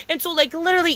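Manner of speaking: ordinary talk, nothing marked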